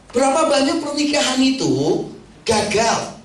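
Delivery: ordinary talk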